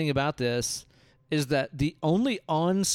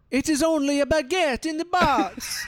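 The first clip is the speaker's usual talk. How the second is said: much higher, hammed-up French accent; 13 semitones higher, 3 dB louder than usual. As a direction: lay the French accent on thick and silly. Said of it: "This is my French accent".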